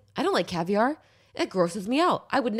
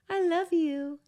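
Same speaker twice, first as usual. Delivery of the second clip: much higher, cooing pet voice; 12 semitones higher than usual. Real, not acted. Coaxing the roommate's cat over, high cooing baby talk.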